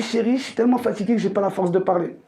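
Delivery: ordinary talk, nothing marked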